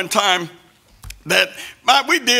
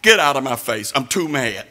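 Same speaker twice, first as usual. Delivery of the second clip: low, heavy voice of a furious mother; angry mama; 8 semitones lower than usual. Retold or imitated. Mother ordering her son out of her face, low and furious. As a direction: growl it down like a mad mama. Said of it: "She said".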